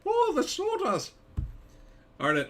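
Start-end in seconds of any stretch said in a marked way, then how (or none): 0.1-1.1 slow, high Irish leprechaun voice; hammy Irish leprechaun squeak. Acted. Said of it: "repeats the leprechaun voice".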